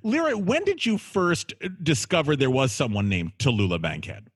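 ordinary talk

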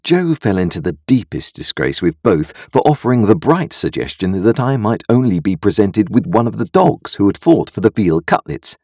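usual speaking voice

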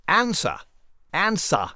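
British ending on answer, American first vowel; Stuart Varney. Acted. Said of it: "that he says".

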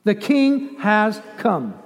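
ordinary talk, nothing marked

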